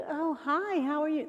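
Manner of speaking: high, sugary put-on greeting; fake friendly. Shown as a demonstration. Volunteer trainer mocking a fake cheery greeting. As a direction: sound fake-cheerful on purpose. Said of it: "don't be fake".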